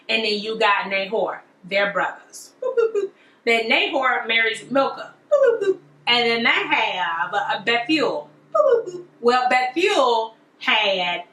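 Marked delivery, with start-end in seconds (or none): 2.6-3.1 high boop boop boop; boop sound effect. Pretend. Explaining the family tree with high boops. 5.3-5.8 high boop boop boop; boop sound effect. Pretend. Explaining the family tree with high boops. 8.5-9.0 high boop boop boop; boop sound effect. Pretend. Explaining the family tree with high boops.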